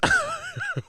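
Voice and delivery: squealing burst of laughter; high laugh. Real, not acted. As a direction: shriek with laughter.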